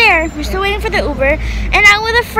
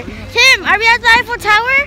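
faster, louder, higher burst of excitement; excited disbelief; 7 semitones higher, 6 dB louder than usual. Real, not acted. Asking Tim on arrival, thrilled and fast. Excited realising they're there.

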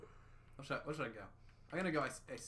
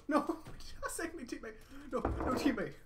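panicked; 13 semitones higher, 3 dB louder than usual. Real, not acted. Being chased in game.

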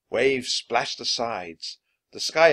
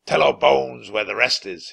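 growled threat; 3 semitones higher, 8 dB louder than usual. Acted. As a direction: growl it like a pirate captain. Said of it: "he growled".

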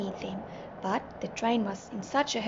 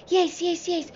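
high-pitched worried exclamation; raised voice, somewhat urgent; anxious cry; 5 semitones higher, 3 dB louder than usual. A narrator's reading. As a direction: cry out in mild alarm. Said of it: "Nelly cried".

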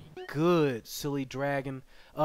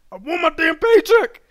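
loud, high demanding yell; high-pitched hammed-up outburst; mock tantrum; 11 semitones higher, 15 dB louder than usual. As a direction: holler it like a cheated worker.

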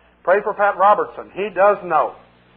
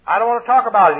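high, louder refusal; sulky demon; 6 semitones higher, 4 dB louder, faster than usual. Retold or imitated. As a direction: refuse to answer. Demon evading the challenge.

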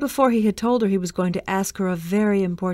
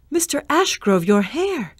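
horrified; 7 semitones higher, 3 dB louder than usual. A narrator's reading. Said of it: "with a horrified gasp".

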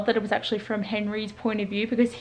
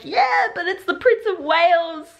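imitating mum, higher and slower; lightly voicing the mum, drawn out; mock mum; 8 semitones higher than usual. Reviewer imitating the mum shrugging off her son.

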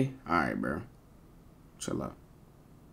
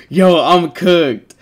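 drawn-out loud groan; 6 semitones higher, 10 dB louder, much slower than usual. Real, not acted. Laughing at own voice message.

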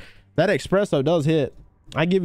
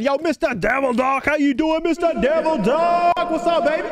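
sing-out stream shout-out, pitched way up; hyped shout-out; 8 semitones higher than usual. Real, not acted. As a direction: holler out a big welcome to a viewer. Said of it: "give somebody a little shout out".

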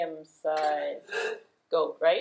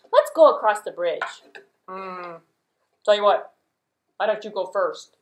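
ordinary delivery, nothing marked

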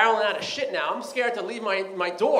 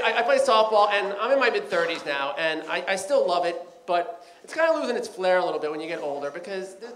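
ordinary talk, nothing marked

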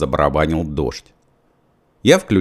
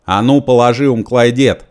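loud, raised, commanding; full voice; 5 semitones higher, 5 dB louder than usual. A narrator's reading. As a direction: order him at full voice. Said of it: "сказал я в полный голос". (во весь голос, громко и требовательно)

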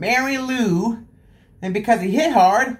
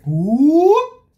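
a high rising whoop, a bit louder; 7 semitones higher, 5 dB louder than usual. Acted. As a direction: swoop the voice up like a flying bucket. Bucket flies up.

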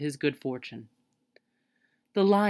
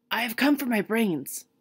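a little uneasy; 7 semitones higher than usual. A narrator's reading. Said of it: "a little uneasily".

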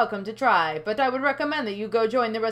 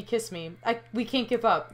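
normal talking voice

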